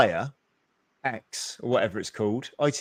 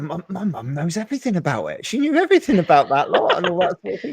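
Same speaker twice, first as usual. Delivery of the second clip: higher, quicker retelling of mum; hint of mum, pitched up with a laugh; lightly voiced mum; 7 semitones higher, faster than usual. Son quoting his mum on royal family gossip, lightly voiced. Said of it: "and mum said".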